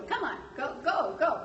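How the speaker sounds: playful shooing. Acted. Speaker jokingly urging an apostle home too long to leave, playful shooing. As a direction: hurry them off with rising, swooping goes. Acting out hurrying a lingering apostle away.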